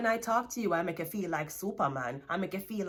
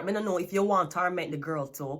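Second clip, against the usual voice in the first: usual speaking voice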